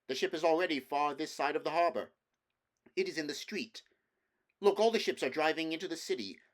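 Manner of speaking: rally the others as the flood comes; urgent prince. Acted. The prince to his companions as the sea rises, urgent and loud.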